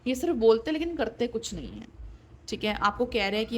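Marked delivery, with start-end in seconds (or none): none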